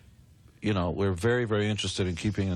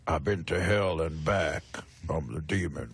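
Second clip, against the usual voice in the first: a hint of a low, slow villain growl; lightly voiced menacing wrestler persona, slow; menacing Demon; 3 semitones lower than usual. Wrestling band member voicing the new Demon character, lightly menacing. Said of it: "That's the basic idea of the guy".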